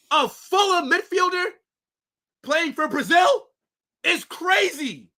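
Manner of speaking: high, slow furious shout; berserk rage. Retold or imitated. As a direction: bellow it in total outrage. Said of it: "I gotta stop yelling".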